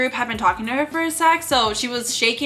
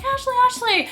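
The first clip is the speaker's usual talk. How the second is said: imitated fan call; 13 semitones higher than usual. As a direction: mimic fans hailing her in a high voice. Imitates fans calling her from above.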